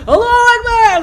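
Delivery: high, loud exclaimed Allahu Akbar, laughing; mock loud takbir. Praying girl imagining a loud Allahu Akbar, laughing. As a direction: blurt it out to startle her. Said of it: "if I'm like".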